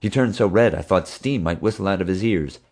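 plain reading voice

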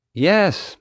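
high, exasperated outburst; exasperated yell. A narrator's reading. Mom finally getting through to the guard, exasperated. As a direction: let it burst out in frustration. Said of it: "Mom yelled, exasperated".

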